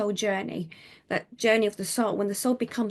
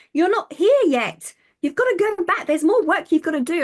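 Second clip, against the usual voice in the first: stern guide, imitated; 9 semitones higher, 8 dB louder than usual. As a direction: send her back like a headmaster. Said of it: "he looked at his thing".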